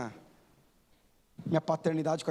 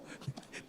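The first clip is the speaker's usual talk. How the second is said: a brief airy chuckle; short breathy laugh; 15 dB quieter than usual. Real, not acted. Pastor amused by daughter's guessing: short breathy laugh. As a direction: let a quick silent laugh slip out.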